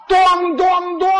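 high, loud "bang" sound effects; three ringing knocks on a wall; imitated banging. Acts out finger hitting cement wall.

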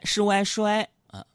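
normal talking voice

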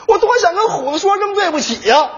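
hammed-up regret.

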